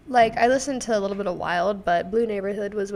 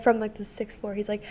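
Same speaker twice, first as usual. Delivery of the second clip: normal talking voice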